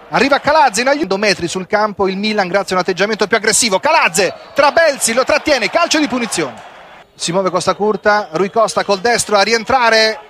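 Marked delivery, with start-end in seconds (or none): none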